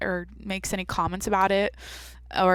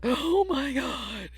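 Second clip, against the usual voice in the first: shocked gasp; 5 semitones higher, 3 dB quieter, much slower than usual. Real, not acted. Scandalised reaction to new podcast.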